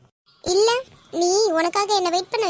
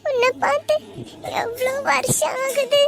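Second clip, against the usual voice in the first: crying; 7 semitones higher than usual. Acted. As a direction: call her name in an emotional reunion. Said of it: "ஏன் அழுறீங்க?".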